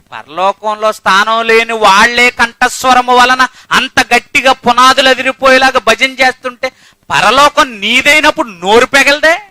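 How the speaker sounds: loud, high, scolding sermon; preaching at full force; fiery preaching. Acted. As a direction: rebuke the flock at full volume.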